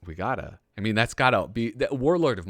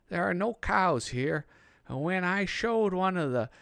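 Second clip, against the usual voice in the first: naive soldier voice; 8 semitones higher than usual. Retold or imitated. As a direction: read it like a dim private writing home. Reading soldier's letter in voice.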